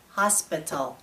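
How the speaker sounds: "hospital" in a monotone, every syllable equal; monotone, no stress. Shown as a demonstration. Teacher shows an unstressed word to learners, "hospital" in monotone. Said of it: "If no syllable is stressed, our voice is monotone".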